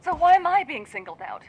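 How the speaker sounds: indignant outburst. Acted. Annoyed witness pushed by detective.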